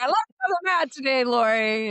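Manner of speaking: high, quick mock report back; exuberant reply. Imitating a friend reporting back after yoga, exuberantly. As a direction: rush it out like a triumphant announcement.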